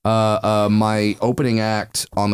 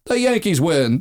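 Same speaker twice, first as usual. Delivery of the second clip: home run call; 8 semitones higher than usual. Retold or imitated. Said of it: "he's the guy that where at the end he goes".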